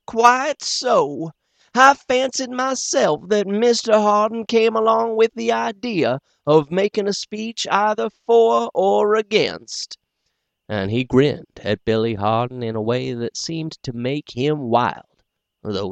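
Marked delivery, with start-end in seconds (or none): 0.0-10.0 high, easy, lightly teasing; gentle gentleman, barely mocking. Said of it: "very gentle and easy".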